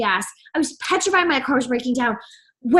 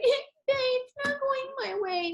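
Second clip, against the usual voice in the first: high, slow, much softer pouty whine; whiny pitiful voice; 9 semitones higher, 9 dB quieter than usual. Retold or imitated. To her partner coming home, fishing for sympathy with a high pouty whine. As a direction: play the sad baby for sympathy. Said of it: "I'd be like".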